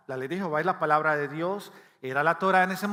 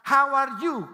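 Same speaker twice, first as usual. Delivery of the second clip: loud, high mock learner's "How are you?"; learner's English; 8 semitones higher, 6 dB louder, slower than usual. Imitating a new immigrant's first loud "How are you?". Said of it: "comenzamos esa".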